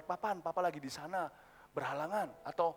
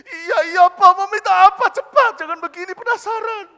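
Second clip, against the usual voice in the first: high, wailing, very loud plea; exasperated child; 7 semitones higher, 17 dB louder than usual. Acted. Child begging father to just say it, exasperated wail. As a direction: cry out in hammed-up impatience.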